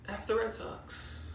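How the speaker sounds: sheepish hushed jab after apologising to Ray Ray; self-censored whisper. Real, not acted. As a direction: swap in the polite letter but keep it hushed. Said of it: "Sorry, Ray Ray, but".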